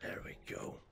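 whisper. Real, not acted. Quiet kill, whispered quip.

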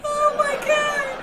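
high, loud, drawn-out squeal; real scandalized shriek; shocked outcry. Real, not acted. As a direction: cry out in amused disbelief. Reacting aloud to shocking story.

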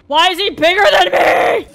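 outraged shout at the giant; panicked yell. Real, not acted. Panicking at a big enemy.

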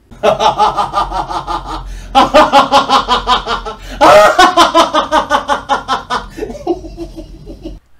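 loud rolling laughter; long loud laughing fit. Real, not acted. Husband laughing hard at the retold reply, loud.

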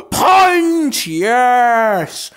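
high, loud victory shout; triumphant shout. Real, not acted. Gamer celebrating knocking an enemy off. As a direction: shout the hit out. Landing a hit.

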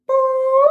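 loud, high-pitched "boop" held on one note. Shown as a demonstration. Violin teacher leads students in a loosening-up warm-up, high boop. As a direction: pop out a high, level boop. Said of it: "Now say".

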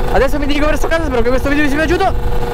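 bright, high vlog sign-off; cheery outro. Acted. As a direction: wrap up like a cheerful YouTuber.

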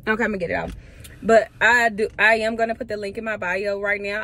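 ordinary talk, nothing marked

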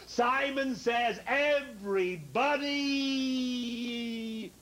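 high, hugely stretched command; suspenseful, long-held high call; drawn-out game call. Pretend. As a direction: hold the last word endlessly to keep them hanging. Game leader stringing out the command.

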